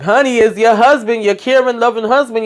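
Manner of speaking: a lightly hammed-up, syrupy husband voice; mock sweet talk. Husband calling Lily's phone, mock sugary. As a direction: coo it like a phony doting husband.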